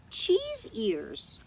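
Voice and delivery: usual speaking voice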